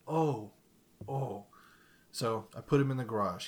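ordinary talk, nothing marked

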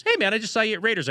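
lightly acted, fast and high hello; lightly voiced hallway greeter. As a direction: imitate a friend calling out in passing. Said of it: "where Q in the hallway goes".

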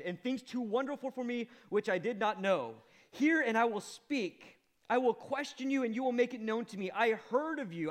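loud and high, earnest; preacher voicing Job's answer to the Lord; fervent confession. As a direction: proclaim it with full conviction. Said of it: "Job answered the Lord".

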